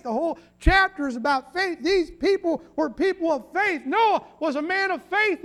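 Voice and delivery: high, loud, insistent pulpit delivery; emphatic preacher in full flow; forceful preaching. Real, not acted. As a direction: hammer each statement home to the congregation.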